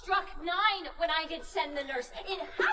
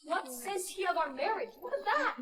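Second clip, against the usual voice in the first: ordinary talk, nothing marked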